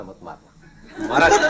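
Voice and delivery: reliving the standoff with the teacher, half joking; retold confrontation. Confronting teacher after the hit.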